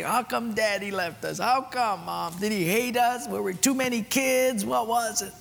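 put-on a small boy asking mom why daddy left; pleading child. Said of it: "I'd be crying".

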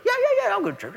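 high-pitched, whiny mock compliance; mock grudging agreement. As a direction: put on a high, brushing-off voice.

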